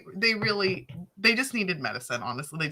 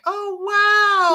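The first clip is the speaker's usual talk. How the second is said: mock amazement; 12 semitones higher, 5 dB louder than usual. Imitates impressed strangers abroad.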